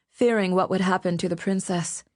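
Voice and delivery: plain reading voice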